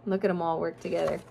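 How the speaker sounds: plain reading voice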